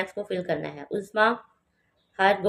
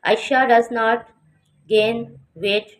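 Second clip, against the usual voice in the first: very slow and louder, each word spaced; slow, loud model reading; 3 semitones higher, 5 dB louder, much slower than usual. Shown as a demonstration. Teacher modelling the sentence slowly and loudly for students. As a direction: read it slowly and loudly for the class. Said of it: "teacher models sentence".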